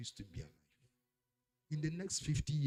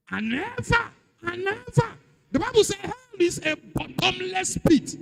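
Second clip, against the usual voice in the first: high, far louder sermon shout; full-force preaching; 16 dB louder than usual. Acted. Preacher warns that hell is bottomless, full-force shout. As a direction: build repeated words into a full shout.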